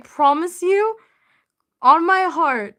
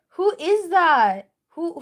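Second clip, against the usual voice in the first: ordinary talk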